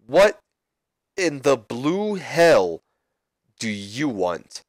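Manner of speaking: very slow, drawn-out sneer; The Rock. Retold or imitated. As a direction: drag it out with disdain. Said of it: "Rock responds".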